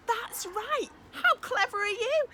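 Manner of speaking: delighted teacher praise; excited praise. Real, not acted. Praising children's answer.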